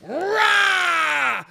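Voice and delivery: high loud mock roar; cat swiping at squirrel, loud mock roar. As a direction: leap out with a big roar.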